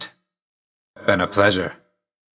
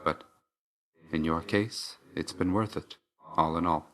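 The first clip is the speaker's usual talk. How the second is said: hushed, reserved sincerity; quiet confiding; 6 dB quieter than usual. Acted. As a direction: confide it softly to a close friend. Quiet personal confession to Zag.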